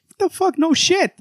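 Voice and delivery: high-pitched exasperated disbelief; real incredulity. Real, not acted. Reader reacting to Superman's clumsy monologue, incredulous.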